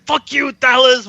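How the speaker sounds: loud mock curse; mock angry. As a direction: curse him out, half-joking. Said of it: "you're just like".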